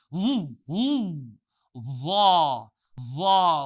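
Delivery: "Woo, woo, law, law." buzzed loud; blender into lam. Shown as a demonstration. Teacher recap of the blender lam. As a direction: build the vibration. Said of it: "the blender said".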